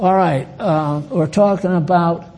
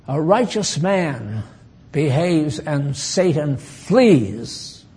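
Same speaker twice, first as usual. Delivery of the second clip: normal talking voice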